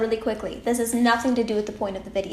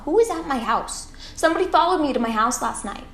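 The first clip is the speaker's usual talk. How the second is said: alarmed; 5 semitones higher, 4 dB louder than usual. Real, not acted. Spooked by stranger at window.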